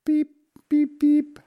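tiny, very high squeaks; duckling peeps. A narrator's reading. Ducklings reply to their mother's offer, peeping. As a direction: answer like hungry baby ducks.

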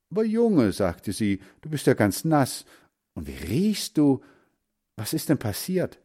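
high, startled scolding; dismayed mother. Acted. Mother lifting her soaking son, startled and dismayed. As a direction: exclaim over the wet boy.

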